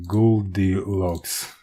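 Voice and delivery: usual speaking voice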